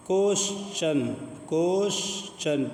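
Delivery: क्वेश्चन said slowly with ch; question with ch. Shown as a demonstration. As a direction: stretch out क्वेश्चन, t sounding as ch. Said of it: "टी के बाद आई ओ".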